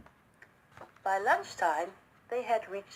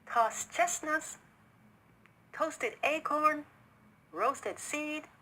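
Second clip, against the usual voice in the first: slow, higher, savouring each dish; proud menu; 6 semitones higher, slower than usual. A narrator's reading. Host names each dish to his guest, proud and slow. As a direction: present each plate like a treasure. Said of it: "he said proudly".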